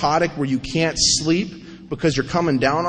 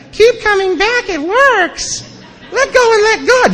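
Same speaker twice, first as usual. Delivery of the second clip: mock chirpy AA greeter; 4 dB louder, slower than usual. Mocking the happy AA people.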